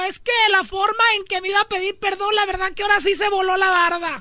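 put-on a girl swooning over the apology; imitating the girlfriend. (novia chismosa con voz aguda)

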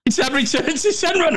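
high, squeaky with laughter; retold line, pitched right up by laughing; helpless laughter. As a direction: squeeze the quote out through giggles. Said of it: "sorry for laughing".

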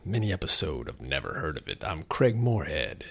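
deep, husky congested radio voice; smoky sultry voice. Acted. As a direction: lean into a low, smoky purr. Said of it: "bring you this smoky, sultry voice".

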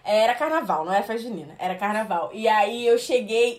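ordinary talk, nothing marked

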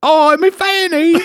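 cheery chimney sweep, hammed-up Cockney; mock Cockney. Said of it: "you're all Cockney".

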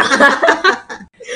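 high laugh bursting out; burst of laughter. Real, not acted. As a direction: laugh loudly at the joke. Laughing after a joke.

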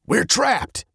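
high, tense cry of alarm. A narrator's reading. Captain sees lava closing in, high and alarmed.

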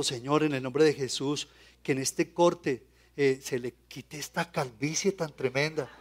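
a quiet inward prayer over the customer's head; silent inner prayer. Retold or imitated. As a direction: murmur the blessing under your breath. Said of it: "por dentro vas a decirle".

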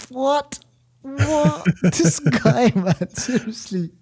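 high, laughing "what?" in disbelief. Real, not acted. To his co-host about the silly line, laughing in disbelief. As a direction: crack up while asking what.

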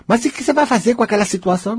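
high skeptical inner voice; doubting inner voice. Acted. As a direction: play the nagging doubt that questions you. Doubting inner voice challenges speaker.

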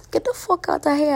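high mock Trini brush-off; bad Trini accent. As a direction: have another go at a playful Trinidadian accent. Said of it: "The accent was so bad".

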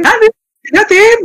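very high incredulous fangirl squeal; excited anime fan. Retold or imitated. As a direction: squeal the question like a shocked idol fan. Imagining [name] members gossiping.

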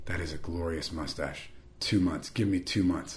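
low, soft and intensely earnest; hushed intensity. Retold or imitated. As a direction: imitate a friend's solemn, hushed pronouncement. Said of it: "with that intensity".

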